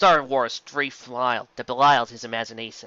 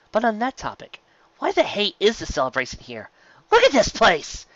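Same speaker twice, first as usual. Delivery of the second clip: exasperated exclaim; 9 semitones higher than usual. Acted. Cannon exclaims about town.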